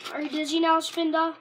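loud, playful taunt at the card; teasing. Pretend. Kid teasing the dizzy Pokemon card, loud and playful. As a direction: tease the Pokemon like a mean kid.

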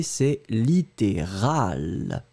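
littéral very slow, ending in a clear "-al"; slow model pronunciation. Shown as a demonstration. Teacher modelling littéral against littéraire. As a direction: draw out littéral syllable by syllable. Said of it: "prenons le temps de bien prononcer les deux".

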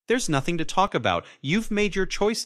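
loud, high-pitched outburst; high, heated rebuke; angry accusation. A narrator's reading. Son rejects father in quarrel.

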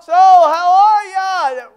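breezy party greeting, stretched out; mock casual host. Parents chatting, ignoring crying kids.

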